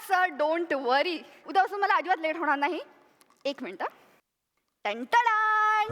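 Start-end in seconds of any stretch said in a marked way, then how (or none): 4.8-5.9 mock fanfare. Unveiling the gift.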